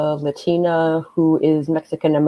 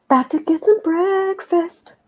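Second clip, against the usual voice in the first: excited anime fan. Pretend. Reading chat in a put-on voice.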